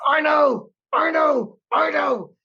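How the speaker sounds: high, loud, hammed-up wail; frantic agreement. Acting out frantic reply to ex-wife.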